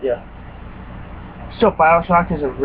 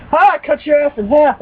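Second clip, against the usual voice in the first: higher, louder battle cry; triumphant shout; 9 semitones higher, 4 dB louder than usual. Retold or imitated. Gamer yelling while cutting a monster in half. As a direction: yell it while blasting the monster.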